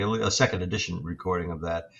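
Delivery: ordinary delivery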